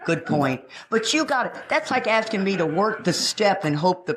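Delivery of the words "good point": low, quiet fake politeness; mock polite nod to a know-it-all, softer; faked agreement. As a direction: pretend to agree while not meaning it. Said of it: "now I can fake it".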